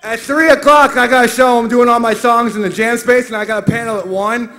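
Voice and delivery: booming into the mic; talking way too loud. Real, not acted.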